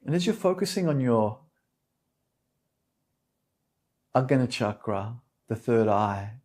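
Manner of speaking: calm meditation guide, unhurried with long pauses; soothing yoga teacher leading a meditation; guided meditation. Real, not acted. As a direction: settle them gently into stillness, phrase by phrase.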